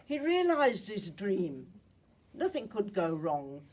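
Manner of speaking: usual speaking voice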